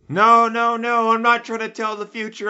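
very high, loud comic protest; mock panicked denial. Accused diviner pleading he is only making giblets, mock panicked and high. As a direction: squeal out an exaggerated excuse. Acting pagan denying fortune-telling.

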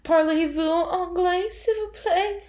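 high, slow, pleading French phrase; exaggerated French attempt. Asking the hotel front desk for help, high, slow and pleading. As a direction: plead in overdone schoolbook French. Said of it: "Do it".

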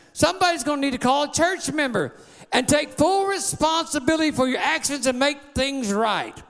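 rising, shouted preacher cadence; a pastor rallying the church against the devil, preaching hard; full-force preaching. Acted.

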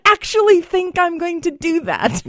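high, loud mock disbelief; hammed-up "are you serious" indignation; incredulous reply. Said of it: "where I would respond like".

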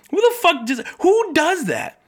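high-pitched, drawn-out incredulity; exasperated disbelief. Real, not acted. Ranting about whoever reported the couch, exasperated disbelief. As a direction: push it way up high, baffled by these people.

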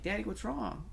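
a hint of a worried four-year-old asking; daughter's voice. Four-year-old daughter asking daddy what's wrong, lightly voiced child.

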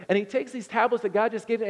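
ordinary talk

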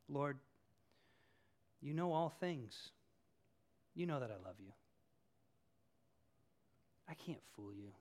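soft, low, humbled admission; humble confession. Retold or imitated. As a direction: give it up quietly, no pretence. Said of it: "there is a profound humility".

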